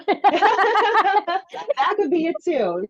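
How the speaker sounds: high, slow words through a laugh; amused, laughing reply. Real, not acted. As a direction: laugh it out as you agree.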